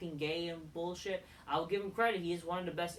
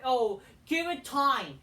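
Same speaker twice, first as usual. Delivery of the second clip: mock excuse; 5 semitones higher, 7 dB louder, slower than usual. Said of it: "Stop with the excuse that".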